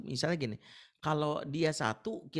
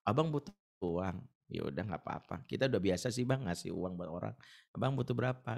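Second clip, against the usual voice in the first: calm friendly reply; 7 semitones lower than usual. Retold or imitated. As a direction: stay kind and unbothered. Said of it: "Langsung dia bilang gitu".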